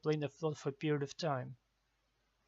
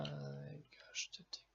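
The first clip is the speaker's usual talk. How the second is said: whisper; 12 dB quieter, much slower than usual. Real, not acted. Frustrated aside while typing.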